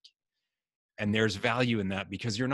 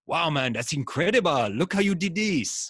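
much higher and louder, bursting with delight; high, laughing cheer of pure encouragement; excited praise; 8 semitones higher, 6 dB louder than usual. Retold or imitated. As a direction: gush over the kid's chart like a thrilled coach. Mentor praising his chart.

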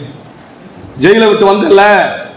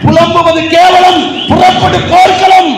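high, drawn-out rallying cry; shouted slogans; 8 semitones higher, slower than usual. Real, not acted. Orator rouses the crowd to battle, shouting slogans. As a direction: belt out each slogan slowly at full pitch.

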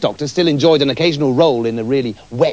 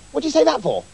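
silly voice; 10 semitones higher, faster than usual. Acted. Silly repeated doctor banter.

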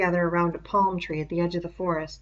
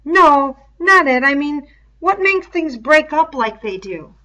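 put-on higher, louder boyish voice of a puzzled kid; an earnest schoolboy thinking out loud, pitched up and loud; Piggy, puzzled; 8 semitones higher, 11 dB louder than usual. Piggy puzzling aloud to Ralph, higher and louder boy voice.

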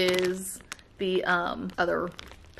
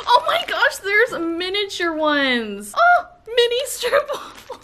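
excited squeal; 11 semitones higher, 5 dB louder than usual. Real, not acted. Delighted unboxing tiny treats.

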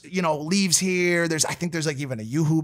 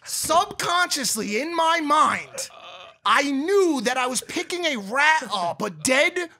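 laughing disbelief; 8 semitones higher, slower than usual. Real, not acted. Realizing he threw a rat.

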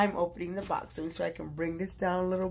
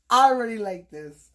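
louder, emphatic delight; 2 semitones higher, 9 dB louder than usual. Real, not acted. Reacting to the first gift, loud and emphatic. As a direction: blurt it out with excitement.

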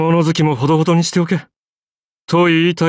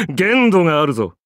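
ordinary talk

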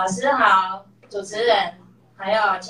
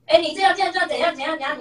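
daughter mimics mom's pitched-up scolding, higher; mock loud scolding; 8 semitones higher, much faster than usual. Daughter imitates mom scolding the bakery clerk, mock angry. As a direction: play the bossy scolding mom, half in jest. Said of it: "你去不要那么大声哦".

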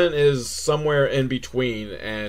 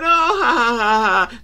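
mock laugh; 9 semitones higher, 4 dB louder than usual.

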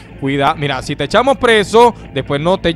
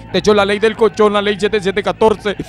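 normal talking voice

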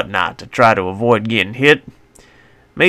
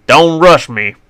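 louder, higher snapped shout; tense yell; 6 semitones higher, 7 dB louder than usual. A narrator's reading. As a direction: shout back under pressure. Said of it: "I shouted in reply".

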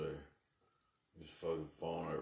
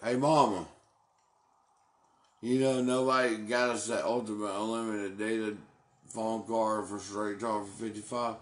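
loud, higher complaint called to Mama; calling out to Mama; 4 semitones higher, 9 dB louder than usual. Real, not acted. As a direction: raises his voice to reach his mom.

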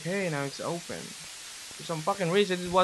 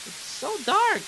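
nervous; 13 semitones higher, 4 dB louder than usual. Real, not acted. Nervous in the dark game.

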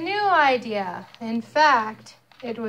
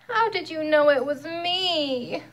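mild wail; 5 semitones higher than usual. Acted. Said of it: "wailed Turkey".

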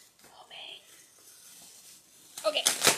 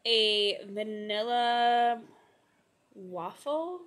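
slow; 3 dB louder, slower than usual. Real, not acted. Savoring the scent.

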